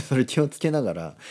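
ordinary talk

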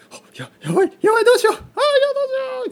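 loud, very high shrieking; hammed-up falsetto panic; frantic panic. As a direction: imitate your own meltdown, gasping then screaming. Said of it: "こんな感じでしたね".

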